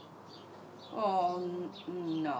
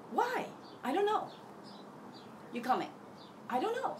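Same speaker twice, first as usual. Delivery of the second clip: back-and-forth quarrel; 10 semitones higher, 4 dB quieter, faster than usual. Retold or imitated. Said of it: "They're like".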